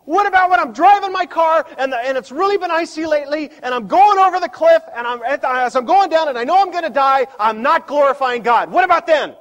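high, loud panicky objector; a frantic skeptic from the pews; mock objector. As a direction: play the worried doubter pleading his case. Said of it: "You say".

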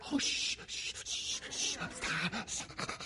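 whispered frantic shh; shushing whisper. Retold or imitated. Parents hushing their screaming kid, urgent whisper. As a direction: hush the child urgently under your breath.

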